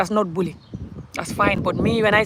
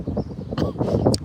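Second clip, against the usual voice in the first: very high, squeaky laugh; cry-laugh. Real, not acted. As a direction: burst into a high helpless laugh. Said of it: "I'm laughing, but I'm crying".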